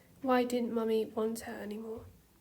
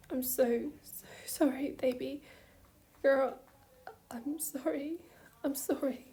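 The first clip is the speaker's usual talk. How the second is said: sobbing whisper; 3 semitones higher than usual. A narrator's reading. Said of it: "Keith sobbed".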